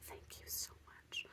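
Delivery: very soft, slow whisper; whispered thanks. Retold or imitated. Thanking the healer in a hushed whisper. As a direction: breathe the thanks almost silently.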